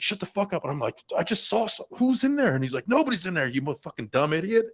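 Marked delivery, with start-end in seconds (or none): 0.0-0.6 high, hissed-sounding mock order; a sergeant shushing a jumpy private; the sergeant telling him to shut up on night watch; annoyed sergeant. Sergeant hushing him again. 1.2-2.6 high, frantic pleading; a spooked Marine insisting to his sergeant; panicked. Retold or imitated. 2.9-4.6 high, mocking scold; the sergeant calling him an idiot; scornful sergeant.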